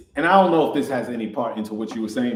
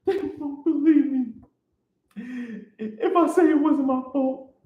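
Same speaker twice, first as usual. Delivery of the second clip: high hysterical voice; 14 semitones higher than usual. Acted. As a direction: squeal it like a panicked man. Hysterical manager, much higher.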